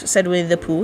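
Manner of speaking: ordinary delivery, nothing marked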